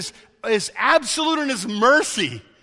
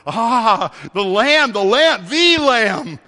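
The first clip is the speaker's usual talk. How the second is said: louder, rapt cry of recognition; awed exclamation; 6 dB louder, much slower than usual. Retold or imitated. Enlightened people recognising the Lamb, loud rapt awe. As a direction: call it out in wonder, ringing. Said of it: "people that are enlightened go".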